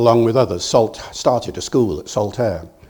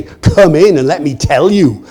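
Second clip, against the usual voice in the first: eager welcome; 4 semitones higher, 7 dB louder than usual. Retold or imitated. Welcoming farmer quote.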